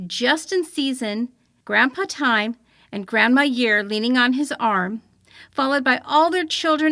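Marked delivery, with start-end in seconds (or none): none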